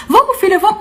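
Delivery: high-pitched imitation of a hurried mother; flustered mother. Mother coming downstairs hurrying daughter, flustered imitation. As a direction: mimic mom rushing in flustered. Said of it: "veio esbaforida descendo".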